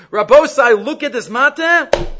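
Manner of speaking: high, loud acted address; teacher acting out a rousing announcement; calling to a crowd. Acting out Moshe addressing the people.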